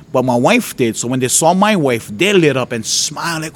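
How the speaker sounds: usual speaking voice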